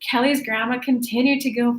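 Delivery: normal talking voice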